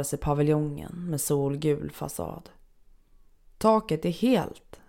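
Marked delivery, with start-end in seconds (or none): 3.6-4.8 patient exclaims to the nurse, surprised; exclaiming in surprise. A narrator's reading. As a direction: blurt it out, amazed at the intact roof. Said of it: "utbrister jag".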